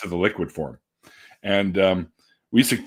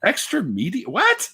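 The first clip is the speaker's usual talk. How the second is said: baffled disbelief; 14 semitones higher, slower than usual. Retold or imitated. Clerk baffled after he leaves.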